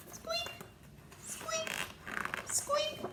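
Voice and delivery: tiny very high squeaks; mouse squeaks. Acted. The mouse on the pillow squeaking.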